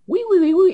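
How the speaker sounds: high, loud falsetto "uy"s; squeaky scampering noises. Pretend. Player mimics how they go along, squeaky noises. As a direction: squeal a string of tiny cries.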